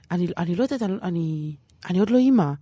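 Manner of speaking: normal talking voice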